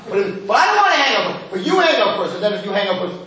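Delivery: high, childish mock protest; lovesick little kids. Teacher imitating engaged couple on the phone, childish whining. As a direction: play the smitten caller refusing to hang up. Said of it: "Like two little kids".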